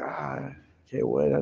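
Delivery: a barman drawn in by the parrot. Retold or imitated. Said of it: "Y el barman le dice". (el barman admirado, lento y suave)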